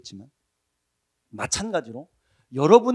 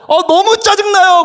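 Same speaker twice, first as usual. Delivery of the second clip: put-on whiny teen; 13 semitones higher, 11 dB louder than usual. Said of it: "그럼 제가 소리 빼게".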